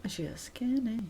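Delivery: whispered under the breath. Real, not acted. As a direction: whisper the remark. Whispered aside.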